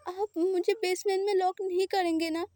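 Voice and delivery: high, tearful small question; crying voice. Acted. Frightened boy asks his partner not to lock him away, tearful. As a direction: ask through a mild sob. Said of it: "रोने वाला voice में".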